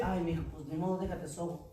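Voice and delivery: gentle comforting parent. Retold or imitated. Parent consoling the child who fell, softly and tenderly. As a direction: hush and comfort the little one. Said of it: "En vez de decir".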